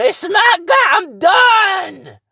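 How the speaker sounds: very high, loud screamed outburst; snapping. Acted. Fed up with a lying friend, screamed outburst. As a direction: scream it like someone finally losing it. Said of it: "before you fucking finally snap".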